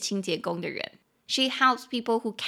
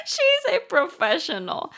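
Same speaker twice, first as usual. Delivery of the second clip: laughing; 7 semitones higher, 5 dB louder, slower than usual. Real, not acted. Laughing at friend's roommate.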